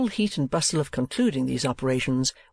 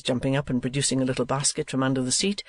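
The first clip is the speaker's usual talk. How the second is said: ordinary delivery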